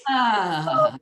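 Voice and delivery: high, loud open "ah"; lots of sound. Shown as a demonstration. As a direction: release a big, high "ah" freely. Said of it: "just lots of sound".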